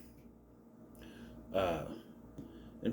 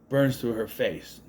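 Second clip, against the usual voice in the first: ordinary talk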